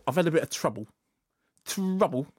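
imitating an actor's put-on cockney; put-on London accent; mock London accent. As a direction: quote him, then relish the last word. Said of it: "when he said, he said".

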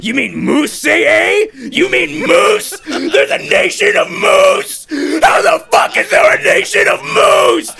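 high, drawn-out comic outrage; slow, screamed rant, hammed up; incredulous shouting. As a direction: yell each question in mounting disbelief. Acted outraged disbelief about moose.

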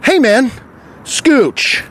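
high, fed-up demand to move over; confrontational order. Retold or imitated. Customer to man blocking the coffee counter, confrontational. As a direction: tell him to move, high and curt. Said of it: "and I go like this".

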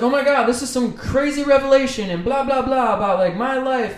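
high, breathless mock revelation; mock gushing. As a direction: parody an overexcited poster. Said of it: "she's like".

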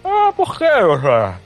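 high, whiny comic protest; mock whiny question. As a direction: whine it like a spoiled patient. Patient mockingly questioning dentist.

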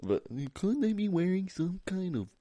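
high, slow, dramatic TV theorist voice; ancient aliens guy impression. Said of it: "you do his accent so perfect".

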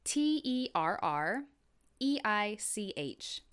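letters spelled slowly one by one; spelling it out. Shown as a demonstration. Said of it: "let me spell that for you".

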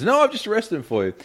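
lightly voiced innocent cop; chirpy, high naive reply; high mock Kojak.

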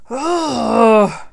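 a loud, fed-up groan of disgust; disgusted ugh. A narrator's reading. Eve groans as Adam blames her, disgusted. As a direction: groan it out, betrayed.

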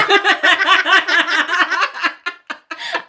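high, loud burst of cackling; long rattling laugh; helpless laughter. Real, not acted. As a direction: crack up uncontrollably. Cracked up by the joke.

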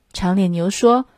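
usual speaking voice

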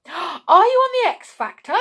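high, loud burst of excitement; excited. A narrator's reading. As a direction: ask like an excited fan. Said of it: "said Raj excitedly".

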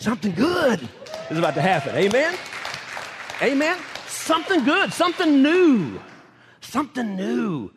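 loud, high shouted preaching; full-force preacher shout. Real, not acted. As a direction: belt it out to the congregation. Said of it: "preach to these people here".

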